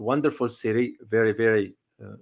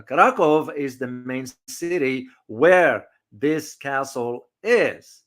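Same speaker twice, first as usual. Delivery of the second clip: usual speaking voice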